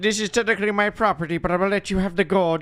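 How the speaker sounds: high put-on villain voice; scheming villain. Villain promising the prospector fame, high put-on wheedling. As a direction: pitch it up, a villain pitching his scheme. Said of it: "And he's like".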